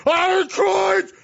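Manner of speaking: high, slow gloating shout; gloating hoarder. Retold or imitated. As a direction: crow over your pile of coins like a miser. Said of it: "they're hoarding and going".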